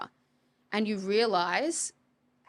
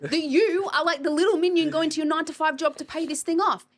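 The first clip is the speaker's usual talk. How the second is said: mocking retort; 8 semitones higher, 6 dB louder than usual. Mocking reply to client.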